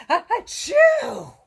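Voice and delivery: high, loud sneezing achoo; high sneeze. Acted. Wolf sneezing at the pig's door, high and loud. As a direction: sneeze it out sharply.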